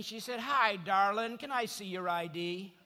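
normal talking voice

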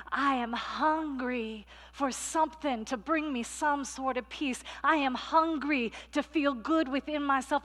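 pleading inner voice. Acted. The hungry soul crying out in the wilderness, pleading. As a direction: beg for something to fill you.

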